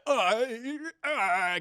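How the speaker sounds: hammed-up inarticulate groaning; brainless monster. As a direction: imitate a villain losing his brain. Imitates brainless villain failing to talk.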